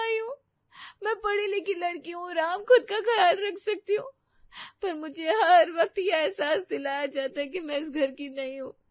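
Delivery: tearful protest; crying. Acted. Preeti to Ram, crying about being treated as an outsider. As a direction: push the words out through tears. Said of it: "अब मैं इसे रोते और नहीं देख सकता।".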